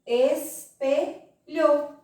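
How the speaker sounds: "Espelhou" very slow in three separate beats; teacher stretching "Espelhou" into its sounds; sounding out syllables. Shown as a demonstration. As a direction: pause after each syllable of "Espelhou". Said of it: "E som?".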